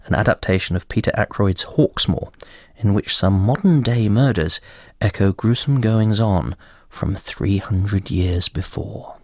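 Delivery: ordinary talk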